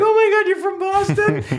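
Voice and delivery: high, gushing mock delight; retold excitement. Retold delight at meeting fellow Bostonian.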